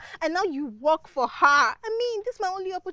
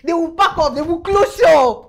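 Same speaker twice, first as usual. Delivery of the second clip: loud, emphatic bragging; excited boast; 12 dB louder than usual. Acted. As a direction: belt out the boast, stretching the end. Boasting excitedly about the visit.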